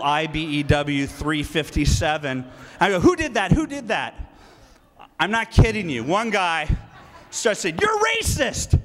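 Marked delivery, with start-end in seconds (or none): 3.0-4.1 high, loud demanding question; confrontational. Retold or imitated. Speaker retelling how he challenged the crowd, confrontational. 7.8-8.8 very high mocking imitation; shrieking accuser. Speaker mocking a union man's accusation, shrieking.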